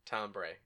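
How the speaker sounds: "timbre" said softly as TOM-bray; low, quiet "timbre" with tom, then bray. Shown as a demonstration. Cohost testing a silly reading, "timbre" as TOM-bray.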